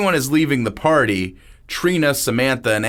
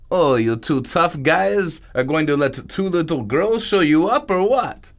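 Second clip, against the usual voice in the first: higher accented voice, mocking the boys; sneering taunt, pitched up; taunting dealer; 6 semitones higher than usual. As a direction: goad them like a cocky foreign dealer. Niku taunting the guys.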